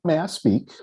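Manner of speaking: sweet overly polite ask; prim, polite request that masks anger; Mary Poppins polite. Retold or imitated. Asking the elders sweetly, politely, secretly mad. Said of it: "Mary Poppins-like".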